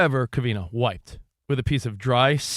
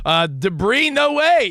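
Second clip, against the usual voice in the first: hyped ad read; 8 semitones higher, 4 dB louder, slower than usual. Acted. Ad read exclamation.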